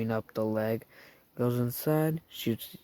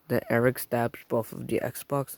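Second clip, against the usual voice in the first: ordinary delivery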